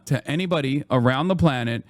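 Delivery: ordinary delivery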